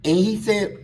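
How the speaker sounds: usual speaking voice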